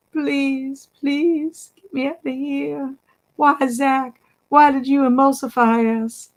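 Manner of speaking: high, small pleading voice; begging groundhog. Pretend. Groundhog in the drawing begging Zach for mercy, high and small. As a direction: beg the artist to undo it. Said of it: "he's begging".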